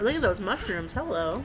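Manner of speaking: usual speaking voice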